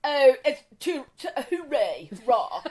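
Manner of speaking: lightly posh rah; a hint of a hooray posh type; mild mock posh exclamations. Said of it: "it's a bit kind of like".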